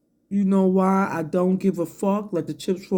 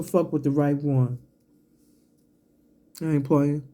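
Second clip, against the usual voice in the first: ordinary talk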